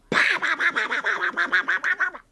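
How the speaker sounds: fast, high rattled strikes; rapid clash sounds. Shown as a demonstration. Storyteller imitating rapid sword and umbrella blows, rapid-fire.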